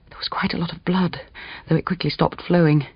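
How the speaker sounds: hushed, whispered line; whispered narration. A narrator's reading. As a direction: drop to a hush.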